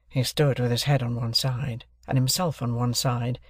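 ordinary delivery, nothing marked

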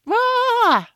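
a long, very high, loud held yell of frustration; frustrated scream. Retold or imitated. Wife vents her pent-up tension at her cranky husband, frustrated scream. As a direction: let it all out in one strangled shout. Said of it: "I just had to, like".

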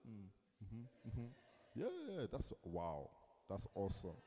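soft, absent-minded mm-hmms, barely listening; bored nodding along. Retold or imitated. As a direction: mimic a polite but uninterested listener. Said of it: "So Pastor Tobi does this".

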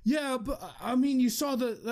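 put-on a rattled kid grasping for an out; flustered kid. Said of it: "And he's like".

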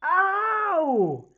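short high yelp of pain. Acted. Someone gets hurt behind the door, sharp pained yelp. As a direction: cry out as if hurt.

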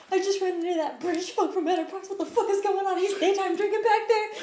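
high, fast, laughing rush; excited and laughing. Retold or imitated. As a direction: spill the news in a giggly rush. Telling friends what happened.

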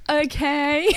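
exaggerated mock okay; mocking imitation. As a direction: make fun of someone's accent, rising at the end. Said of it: "make fun of how I say".

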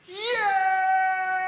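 very high, loud, drawn-out cry of joy; acted ecstatic yell; jubilant shout. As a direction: explode with joy, stretching the word. Guard rejoices at the news.

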